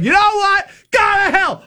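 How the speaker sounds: high, loud furious yell; screaming. Retold or imitated. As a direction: blow up and hang up. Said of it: "And I just started screaming".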